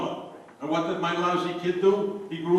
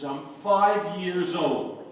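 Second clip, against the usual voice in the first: slow deliberate answer; much slower than usual. Acted. Child lying about age, slow.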